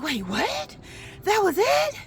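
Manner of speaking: high-pitched, disbelieving questions; incredulous. Retold or imitated. To the visitor who says that's all, high disbelief. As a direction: re-enact baffled surprise, voice leaping up.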